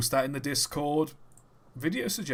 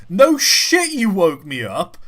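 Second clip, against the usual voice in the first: loud, high outburst dropping fast; acted-out indignant retort; exasperated retort; 6 semitones higher, 11 dB louder than usual. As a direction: snap back loudly in disbelief. Irritated retort to woken-up apology.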